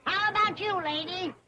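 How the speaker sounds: carnival barker drawing in a crowd; street performer. Acted. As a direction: hawk it to passing fairgoers. Medicine show barker pitch.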